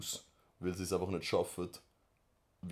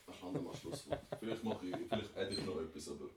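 moving away from mic; 6 dB quieter than usual. Real, not acted. Leaving for the toilet.

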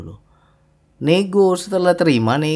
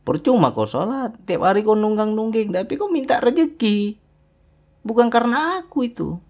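higher, stern reproach; rebuking divine voice; 8 semitones higher than usual. Retold or imitated. Preacher voices God rebuking a praying believer. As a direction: speak as God scolding the worshipper. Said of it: "seolah-olah Allah tuh ngomong".

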